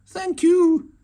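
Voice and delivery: a high, squeaky little thank you; squeak. A narrator's reading. Hedgehog thanks Mouse for gift, high squeak. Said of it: "squeaked Hedgehog".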